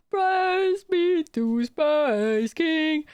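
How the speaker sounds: mock reverent. Mock worship.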